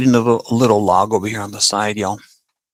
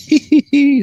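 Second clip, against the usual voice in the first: burst of laughter; 7 dB louder than usual. Real, not acted. Bumped the camera, laughs.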